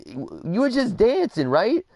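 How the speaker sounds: high, drawn-out imitation of Dad checking; confronting dad. Dad storms up to his son to check, insistent. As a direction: press the question slowly in a high voice. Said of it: "he just storms up to me".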